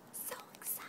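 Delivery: breathy whisper of excitement; whispered aside. Real, not acted. Whispering to viewers how excited she is. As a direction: whisper it to yourself, thrilled. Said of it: "whispered to herself".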